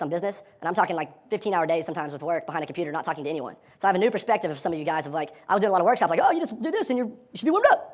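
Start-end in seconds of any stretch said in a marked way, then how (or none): 6.2-7.9 high-pitched mock advice, lightly tossed off; higher, offhand self-imitation; glib advice. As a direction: voice your old breezy advice, pitched way up. Voicing his old glib advice.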